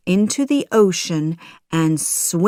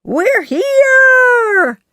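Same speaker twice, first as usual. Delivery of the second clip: high, loud arrival cheer; shouting; 9 dB louder than usual. A narrator's reading. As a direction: shout it out with the last word stretched. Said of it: "shout the boys".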